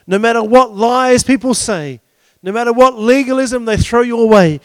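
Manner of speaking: loud, driving preacher's build; forceful preaching. Acted. Preacher rallying against what binds you, forceful preaching. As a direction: drive the list home with force.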